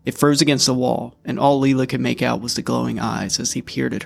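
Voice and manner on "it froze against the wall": usual speaking voice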